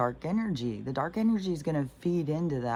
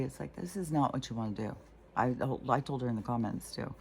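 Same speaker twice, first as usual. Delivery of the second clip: ordinary talk, nothing marked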